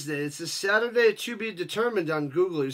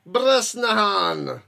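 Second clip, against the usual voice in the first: mock Russian pronunciation; 2 semitones higher, 5 dB louder, much slower than usual. Shown as a demonstration. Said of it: "It's not Russian".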